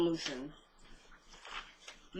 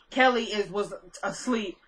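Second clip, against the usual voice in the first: usual speaking voice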